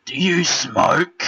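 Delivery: high, loud, slow put-on voice of a stranger; strange man's voice. Imitating the weird man outside Aldi, high and loud. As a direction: drag out the odd stranger's question, loud and high. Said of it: "I'll try and get his voice right".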